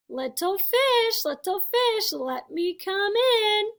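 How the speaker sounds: high, slow singsong villain call; drawn-out, insistent storybook shark; big bad shark. A narrator's reading. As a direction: swoop up and stretch every phrase. Shark calls at the door again.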